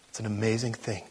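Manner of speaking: ordinary talk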